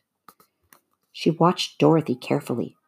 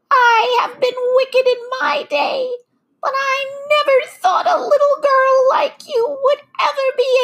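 wailing witch; 5 dB louder than usual. A narrator's reading. The melting witch bids Dorothy farewell, wailing high. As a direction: lament your end in a piercing witch screech.

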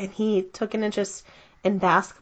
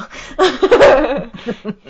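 big hearty laugh; loud laugh; 4 semitones higher, 7 dB louder than usual. Real, not acted. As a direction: burst out laughing. Laugh after quote.

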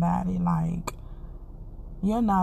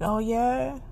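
playful God voice, higher, hyped and teasing; mock God voice, higher, gently bragging; voicing God; 5 semitones higher than usual. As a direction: tease like God showing off what he can do. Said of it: "he be like".

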